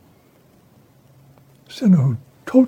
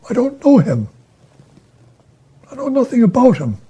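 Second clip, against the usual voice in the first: high, loud, insistent denial; vehement denial; 13 semitones higher, 4 dB louder, faster than usual. Acted. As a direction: deny him hard, voice raised and high. Peter denies Jesus under oath.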